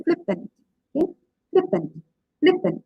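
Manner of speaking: FLIP-pant, first syllable stronger, second dropping; stress on first syllable. Shown as a demonstration. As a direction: hit FLIP in flippant, let -pant fall away. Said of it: "the first part sounds louder".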